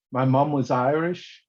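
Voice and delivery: ordinary talk, nothing marked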